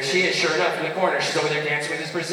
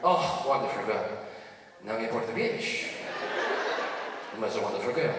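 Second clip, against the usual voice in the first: put-on lower, softer old grandfather; a hushed old man confiding; grandpa voice; 5 semitones lower, 7 dB quieter than usual. Grandpa whispers his secret to his grandson, low and soft. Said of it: "he goes".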